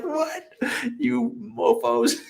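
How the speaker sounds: jokingly offended at friends insulting his car; mock outrage. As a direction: play it hurt and indignant, laughing. His reaction to the mechanics.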